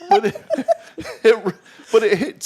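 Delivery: laughing through broken words, high. Real, not acted. Host laughing at his own joke, giggling.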